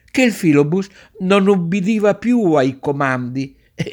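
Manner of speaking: plain reading voice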